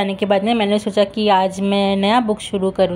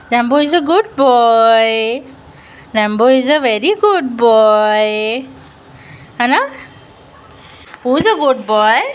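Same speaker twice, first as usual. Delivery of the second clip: slow dog praise; 2 semitones higher, 4 dB louder, much slower than usual. Real, not acted. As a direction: stretch every word praising the dog.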